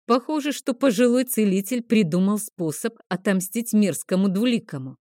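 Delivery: usual speaking voice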